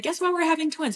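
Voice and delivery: high-pitched, soft retold excitement; excited announcement. Brother calling from the doctor's office with big news, excited.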